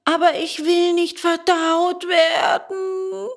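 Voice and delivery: high, loud, drawn-out puppet howl; loud tearful protest; howling wail. A narrator's reading. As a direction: wail it out slowly at high pitch. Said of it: "heulte Bengele".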